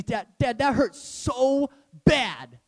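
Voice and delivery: put-on slow, whining little boy; whiny kid. Son after a spanking, whining slowly. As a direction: stretch it out like a hurt child. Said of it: "and he".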